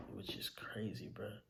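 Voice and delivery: breathless, voiceless hush; the words barely get out; whispered grief. Real, not acted. Son grieving his mother's death, whispering in disbelief.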